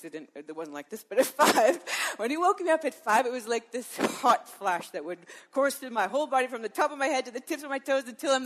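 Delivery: ordinary delivery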